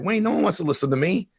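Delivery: high-pitched self-doubting protest. Retold or imitated. Protesting to mentor, retold.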